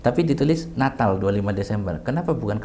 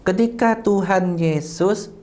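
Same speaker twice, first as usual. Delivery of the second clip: slow, raised, word by word; higher, deliberate teaching delivery; slow emphatic recital; 8 semitones higher, much slower than usual. Shown as a demonstration. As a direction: spell it out slowly and emphatically for slow listeners. Slow emphatic restatement for listeners.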